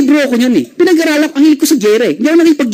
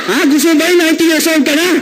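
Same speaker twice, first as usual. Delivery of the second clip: ordinary talk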